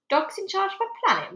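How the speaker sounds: high mocking disbelief. Major mocks the girl's dog planet idea, mocking. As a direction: sneer the question up high. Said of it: "mocked the Major".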